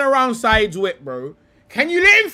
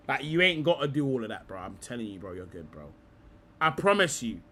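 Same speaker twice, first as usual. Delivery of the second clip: mock reassurance; 7 semitones lower than usual. Mock consoling the pundit.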